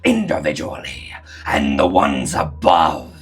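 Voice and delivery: menacing cosmic devourer; monster voice. Acted.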